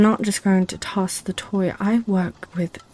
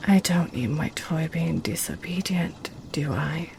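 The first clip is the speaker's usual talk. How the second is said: softer, sly whisper; 3 semitones lower, 7 dB quieter than usual. Acted. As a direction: whisper it as a wicked tease. Succubus sly low tease.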